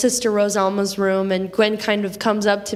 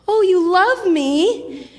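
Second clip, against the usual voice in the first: put-on tender old lady; 8 semitones higher, slower than usual. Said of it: "She says".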